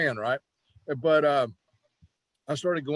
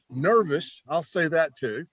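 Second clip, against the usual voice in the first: normal talking voice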